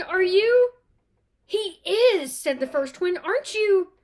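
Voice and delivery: high, loud, eager questions; excited twins. Acted. Twins thrilled to meet Harry, loud eager disbelief. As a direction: fire it off in wide-eyed excitement.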